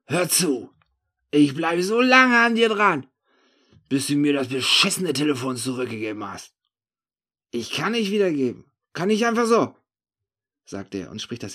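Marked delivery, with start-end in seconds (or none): 0.0-6.5 loud warning, high in the middle; angry threat. Acted. Narrator vowing to stick to the thief, angry threat. 7.5-9.8 high, loud excuse; agitated protest. Acted. Cornered thief saying he cannot return it, agitated protest.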